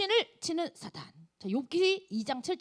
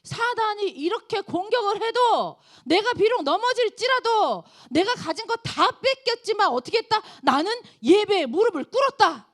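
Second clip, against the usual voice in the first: preaching at full force; 8 semitones higher, 8 dB louder than usual. Acted. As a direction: declare it from the pulpit. Preaching climax. (힘차게 외치는 설교)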